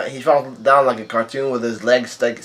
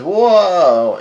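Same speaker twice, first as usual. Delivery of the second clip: long, loud, high whoa; higher, louder exclamation; astonished; 6 semitones higher, 6 dB louder than usual. Retold or imitated. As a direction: draw it out in mock amazement. Bege reacts to getting his heart.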